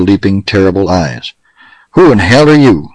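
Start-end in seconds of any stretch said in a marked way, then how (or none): 1.9-2.9 sharp, higher and louder challenge; piercing demand. A narrator's reading. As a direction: cut into him with the question. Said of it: "queried Pecos piercingly".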